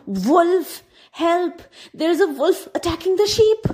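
high, loud fake cry for help. A narrator's reading. Boy pranking villagers, loud high cry for help. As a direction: yell for help down the mountain. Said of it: "and louder".

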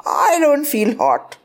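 high, slow insistence; unconvincing denial. A narrator's reading. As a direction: protest a bit too much. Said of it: "I said, wiping my brow".

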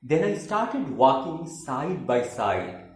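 emphatic declaimed verse with high phrase openings; theatrical poem reading with swooping intonation; poetry recitation. Acted. Recites third poem, In Return.

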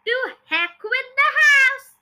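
high, loud, drawn-out dismissal; defiant shout. A narrator's reading. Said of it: "she shouted".

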